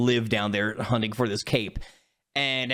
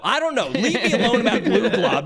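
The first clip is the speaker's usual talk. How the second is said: higher, louder pestered protest; mock exasperation; 7 semitones higher, 6 dB louder than usual. Chodius fending off Gluglob questions, mock exasperation. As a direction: brush them off, fed up.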